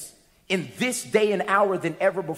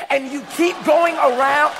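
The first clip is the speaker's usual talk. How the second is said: preaching shout; 6 semitones higher, 6 dB louder than usual. Real, not acted. Preacher shouts teasing crowd.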